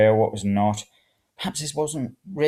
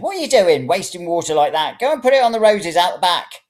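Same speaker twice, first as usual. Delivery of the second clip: high, loud nagging; scolding old woman; 8 semitones higher, 6 dB louder than usual. A narrator's reading. Old woman scolds him for wasting water, high and loud.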